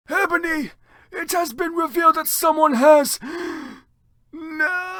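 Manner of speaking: put-on very high, squeaky old wizard voice; Dumbledore shouting. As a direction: cry out in a shrill panic. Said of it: "he shouted".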